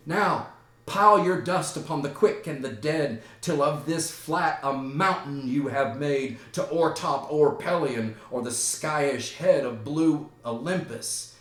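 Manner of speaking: ranting grief. Acted. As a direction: proclaim grief to the heavens. Laertes rants inside the grave.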